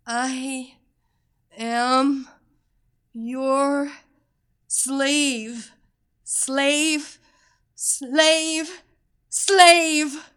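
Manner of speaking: climbing in pitch and power each time; rising repetition. A narrator's reading. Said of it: "each repetition of the word slave".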